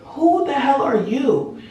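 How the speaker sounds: high, slow demanding; alarmed demand. Retold or imitated. Demanding the stranger say who he is, high and slow. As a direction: draw out the challenge. Confronting the strange man again.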